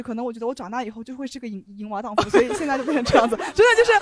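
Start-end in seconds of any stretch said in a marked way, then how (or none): 3.4-3.9 much higher and louder, laughing; laughing at her own joke. Real, not acted. Laughing at her own confession to the hosts.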